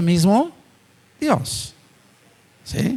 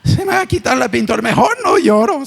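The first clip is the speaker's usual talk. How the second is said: mock dainty fretting, pitched higher; woman holding back tears; 7 semitones higher than usual. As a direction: play a tearful woman worried about her makeup. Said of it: "empieza a salir la lágrima y dice". (imitando a una mujer llorosa preocupada por su maquillaje, voz aguda)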